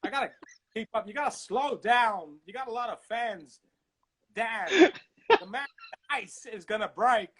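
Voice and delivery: slow, swooping star impression; Christopher Walken. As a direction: break the phrases in strange places, drawn out. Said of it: "Wait, Christopher Walken".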